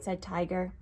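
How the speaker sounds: ordinary delivery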